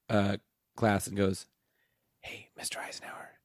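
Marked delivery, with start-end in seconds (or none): 2.2-3.4 conspiratorial whisper. Retold or imitated. Student sneaking up to the teacher, whispering. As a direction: hush it like a secret to the teacher.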